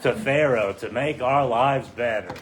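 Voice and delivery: slow, drawn-out sarcastic thanks; mock gratitude. Israelites sarcastically thanking Moses and Aaron for standing up to Pharaoh, mock gratitude. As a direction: draw out the praise with heavy sarcasm.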